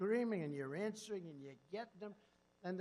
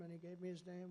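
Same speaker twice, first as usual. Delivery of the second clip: ordinary talk, nothing marked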